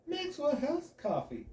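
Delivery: high, slow, grinning brand name yell; overdone cheesy shout of the name; practically screaming. Acted. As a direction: belt the brand name with a huge smile. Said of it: "I practically scream the brand name".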